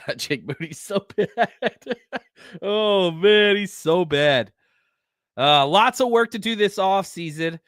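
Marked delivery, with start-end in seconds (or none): 0.0-4.5 laughing in disbelief. Real, not acted. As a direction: chuckle through it, exasperated. Laughing at the kicker's misses.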